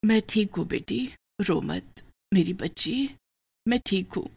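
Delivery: put-on a frail mother just waking, soothing her child; barely above a breath, tender; weak and soft. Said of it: "धीरे से बोली".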